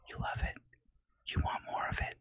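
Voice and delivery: soft, breathy mock-sultry whisper. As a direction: whisper it teasingly close. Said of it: "my sultry voice".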